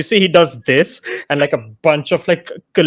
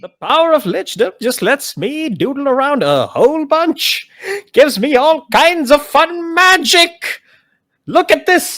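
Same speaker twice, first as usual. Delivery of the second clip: high, slow bragging voice; lich voice; 10 semitones higher, slower than usual. Acted. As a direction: show off your doodles. Lich brags about magic.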